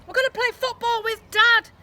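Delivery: put-on high, loud kid's shout; excited yell. A narrator's reading. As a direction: yell it bursting in. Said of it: "yelled Lester".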